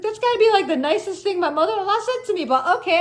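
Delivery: high, loud incredulous sarcasm; ironic disbelief. Retold or imitated. Telling her mother-in-law it's the nicest thing, high and sarcastic. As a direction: sound floored but play along.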